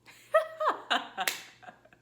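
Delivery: high, loud burst of laughter; real giggling fit, high and loud; laughing. Real, not acted. Cracking up after fumbling the intro, laughing. Breaks up after repeated takes.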